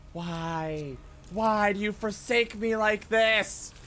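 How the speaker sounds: ordinary talk, nothing marked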